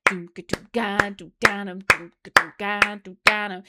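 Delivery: beatbox the groove, "doom-ka-doom-ga" punched out; vocal drum rhythm. Retold or imitated. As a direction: mimic the drum pattern with your mouth. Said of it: "the rhythm has went".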